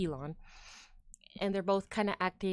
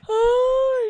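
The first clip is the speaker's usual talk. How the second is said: big high loud yawning oh; 12 dB louder than usual. Real, not acted. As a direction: let a huge yawn stretch the oh. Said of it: "I'm sorry for the yawning".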